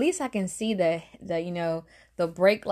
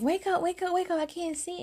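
retold freak-out in a raised pitch; retold panic; 10 semitones higher than usual. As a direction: urgently rouse a sleeper in a high-pitched panic. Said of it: "So I'm freaking out".

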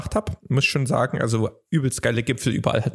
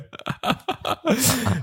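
lautes Lachen; 12 semitones higher than usual. Real, not acted. Lacht über Kaffee-Frage.

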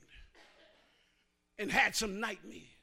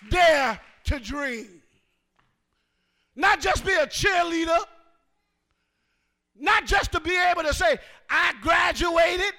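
full-force preaching; 5 semitones higher, 13 dB louder than usual. Real, not acted. Pastor exhorting the congregation, full-force preaching. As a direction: preach it to the back row.

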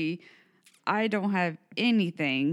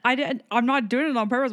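dry mouth; 4 semitones higher, 4 dB louder than usual. Real, not acted. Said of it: "My mouth is dry".

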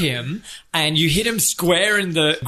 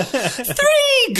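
referee count. Acted. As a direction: shriek the count.

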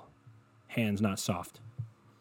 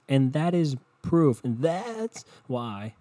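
slow, louder dramatic buildup; mock solemn buildup; 4 semitones higher, 3 dB louder, much slower than usual. Real, not acted. Admitting his own mistake, building slowly to the punchline. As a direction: build it up like a grand reveal.

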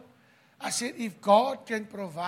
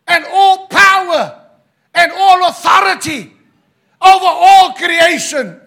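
booming, rising preacher's voice of God; God speaking to Job; 4 semitones higher, 12 dB louder than usual. Acted. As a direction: thunder God's words over the crowd, building ever louder. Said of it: "He's saying to Job".

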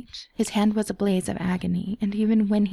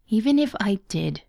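sneering; 2 semitones higher than usual. A narrator's reading. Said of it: "Pietro sneered".

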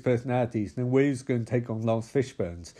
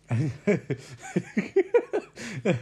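laugh; 7 semitones higher than usual. Real, not acted. Laughing at own joke.

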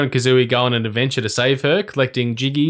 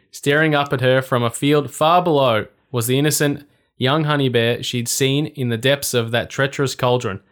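ordinary delivery

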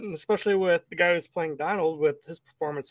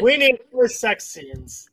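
joking mock demand; 7 semitones higher, 7 dB louder, slower than usual. Real, not acted. Joking interjection.

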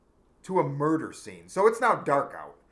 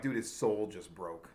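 awed inner thought; 9 semitones lower, 9 dB quieter than usual. Retold or imitated. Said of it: "looking at him thinking".

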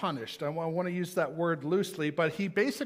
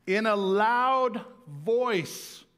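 "In a loud voice" drawn out slowly, higher and louder, leaning on "loud"; emphatic, slow; 4 semitones higher, 5 dB louder, much slower than usual. Shown as a demonstration. Preacher stressing the verse to his church, slow and loud. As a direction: stretch "In a loud voice" and punch "loud". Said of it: "say it in a loud voice".

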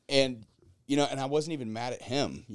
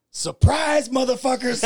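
high, loud gleeful shout; spring the trap with glee; triumphant kid shout; 14 semitones higher, 9 dB louder, slower than usual. Retold or imitated. Kid springs his long game on mom, gleeful shout. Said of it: "he goes".

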